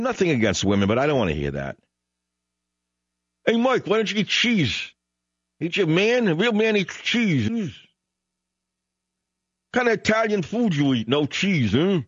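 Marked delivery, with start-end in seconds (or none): 3.4-12.0 high-pitched put-on needling voice; mock caller's badgering; mocking heckler voice. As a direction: imitate a pestering heckler, pitched way up. Mimics a heckler asking about cheese.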